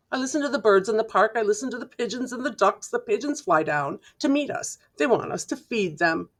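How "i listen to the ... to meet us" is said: ordinary delivery, nothing marked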